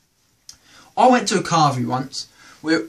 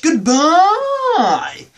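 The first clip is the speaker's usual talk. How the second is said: cheery goodbye; 11 semitones higher, 5 dB louder than usual. Real, not acted. Signing off the show.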